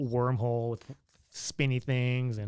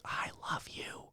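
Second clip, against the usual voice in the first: slow, hushed mock-swoon; adoring whisper; 13 dB quieter than usual. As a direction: whisper it slowly, as if smitten. Overcome fan reply to nurse.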